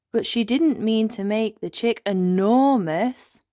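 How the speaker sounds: plain reading voice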